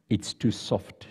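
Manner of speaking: slow, soft sighing remark. Acted. Angel inspecting the mother model, soft slow sigh. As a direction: let it out on a quiet sigh. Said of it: "she sighed".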